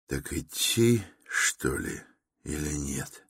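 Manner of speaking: very soft, hesitant musing; inner thought. A narrator's reading. Raskolnikov wavers alone at the crossroads, softly musing. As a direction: think aloud, barely voiced. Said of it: "думал Раскольников".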